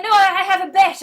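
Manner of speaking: loud, high-pitched retort, hammed-up; insistent mock call. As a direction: play the knight loudly insisting. Knight insisting to the crowd.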